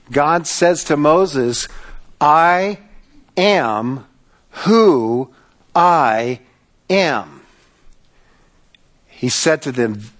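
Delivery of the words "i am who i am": very slow, a pause after each word; solemn word by word. Acted. God declares his name to Moses, solemn and slow. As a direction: spell it out weightily, word by word.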